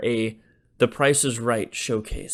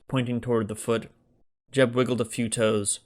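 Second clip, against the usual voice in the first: ordinary delivery, nothing marked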